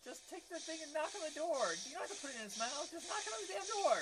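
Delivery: high, loud exasperated shout; yelling at the screen. Real, not acted. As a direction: shout at the character to just do it.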